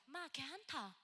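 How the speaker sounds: pastor's Gyeongsang dialect, lightly imitated; softly imitating a Gyeongsang pastor's comfort. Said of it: "경상도 사투리를 너무 심하게".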